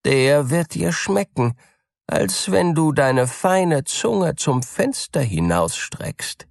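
high, mocking cat voice; sly cat. A narrator's reading. Cat answering the mouse, high and mocking. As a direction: answer with hidden spite. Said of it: "antwortete die Katze".

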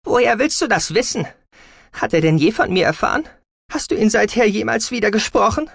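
loud, high interrogation; heated, accusing daughter; angry challenge. A narrator's reading. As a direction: fire the questions at mother. Daughter fires questions at mother.

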